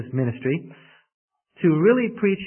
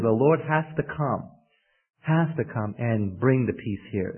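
usual speaking voice

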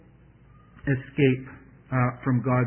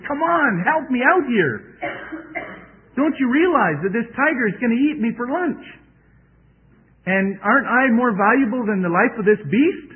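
high, loud, frantic begging; trapped farmer pleading for help, high and frantic; desperate plea; 9 semitones higher, 5 dB louder than usual. Acted. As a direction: beg for your life from the tiger.

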